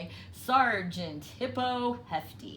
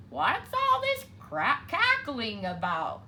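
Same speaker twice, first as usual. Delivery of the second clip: a bit louder demanding duck; 6 semitones higher, 5 dB louder than usual. A narrator's reading. Ducky Lucky demanding to know about the noise. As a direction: ask it like you want answers now. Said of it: "he demanded".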